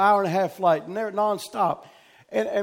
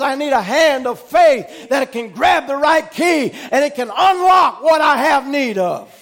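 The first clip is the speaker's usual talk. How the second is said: loud, driving sermon cadence; full-force preaching; 7 semitones higher, 10 dB louder than usual. Acted. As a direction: proclaim it to the congregation, hammering each repeated phrase.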